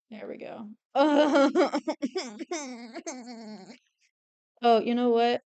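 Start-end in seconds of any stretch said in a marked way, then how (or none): 0.9-3.7 long, quavering giggle-whine; wavering whimpering laugh. Real, not acted. Artist swooning over own drawing, whimpering laugh. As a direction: let a laugh dissolve into whimpers.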